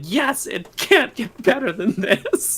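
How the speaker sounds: high, slow laughing cheer; retold crowd hype, high and slow with laughter; excited cheer. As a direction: cheer it out, giddy. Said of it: "We're like".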